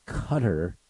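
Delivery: "Qatar" said like "cutter"; "Qatar" as CUT-er, short first vowel; newscaster pronunciation. Retold or imitated. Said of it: "as the news people say".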